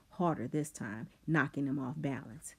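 plain reading voice

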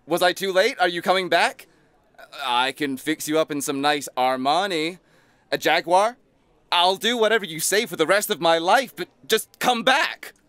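loud, high pleading shout; desperate calling out. Acted. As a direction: beg someone who left to come back, building to a cry. Desperate plea after someone leaving.

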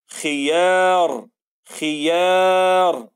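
خيار very slow, the ya held long; stretched خيار. Shown as a demonstration. Teacher reading خيار with a long, very slow vowel. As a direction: drag out the a in خيار. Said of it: "طولنا في صوت الياء".

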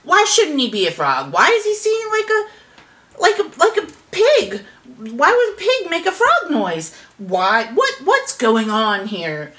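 loud, high overlapping-style squabble; shouting argument. A narrator's reading. Animals arguing with each other over the pig, shouting. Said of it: "everyone started shouting at each other".

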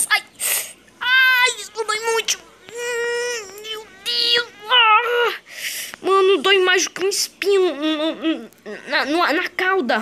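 high, wailing moans of pain; hammed-up crying over a hurt paw; pained whimper. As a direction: whine it like the foot is on fire. Wounded dinosaur whimpering.

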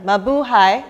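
rising, loud festive greeting; loud greeting call. Real, not acted.

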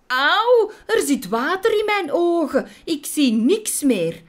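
high, loud squealing wasp; acted shriek of a blinded victim; pained yelling. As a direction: cry out in alarmed pain. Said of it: "schreeuwde Bok".

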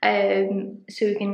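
ordinary talk, nothing marked

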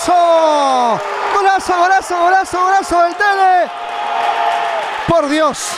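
breakneck goal commentary. Acted. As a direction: stretch out the golazo. Big goal celebrated.

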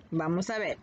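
normal talking voice

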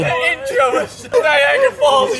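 laughing. Real, not acted. Laughing after painful fall.

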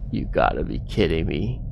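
low, soft, deflated disbelief; quiet dismay with a rising end; hushed disbelief. Real, not acted. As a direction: say it under your breath, dismayed. Has to redo the chase.

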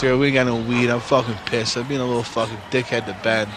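ordinary talk

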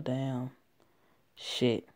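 plain reading voice

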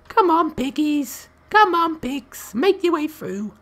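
very high, slow, sing-song call; cutesy high pig-calling voice. Acted. Gamer coaxing pigs through a gap in a squeaky high voice. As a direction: coax the little piggies like talking to babies.

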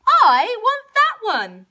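high, loud, drawn-out demand; insistent high bellow; demanding cow. A narrator's reading. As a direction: stretch it out like a tantruming cow. Cow demands another dress.

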